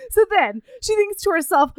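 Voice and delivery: usual speaking voice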